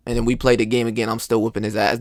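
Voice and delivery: ordinary delivery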